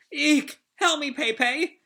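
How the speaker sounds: high mock squeal for help; hammed-up damsel shriek; Ulti. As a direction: squeak it out like a trapped character. Acting caught Ulti calling for help.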